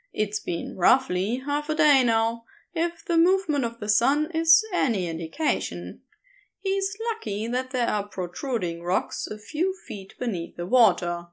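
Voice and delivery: usual speaking voice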